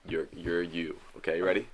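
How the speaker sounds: ordinary talk, nothing marked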